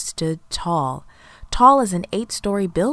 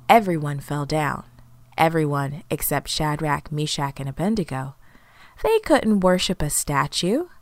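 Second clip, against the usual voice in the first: usual speaking voice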